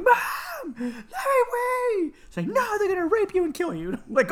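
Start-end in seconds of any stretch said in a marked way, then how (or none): 0.0-2.1 high, drawn-out mock wail; imitated whining child. Child to mom pulling the wrist leash, imitated whining. 2.5-3.9 high-pitched overprotective alarm; imitated panicky mom. Mom refuses to free leashed child, imitated panic.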